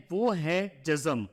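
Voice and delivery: ordinary talk, nothing marked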